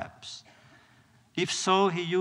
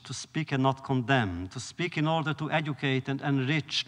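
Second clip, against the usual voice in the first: usual speaking voice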